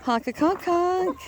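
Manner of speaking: slow, stretched honk with weight on conk; slow drawn-out goose call. Pretend. Man repeats goose greeting, slow drawn-out honk. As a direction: draw out the conk like a lazy honk.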